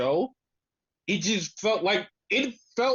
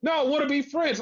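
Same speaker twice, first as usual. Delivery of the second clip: higher-pitched mock plea; imitating Cash; 8 semitones higher than usual. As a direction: imitate a wrestler begging to be friends. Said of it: "He's like".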